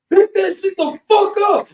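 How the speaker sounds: high, sharp commanding shout. Retold or imitated. Bobby yells at the guy who kicked the can, commanding shout. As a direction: order him to clean it up. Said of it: "raises his voice".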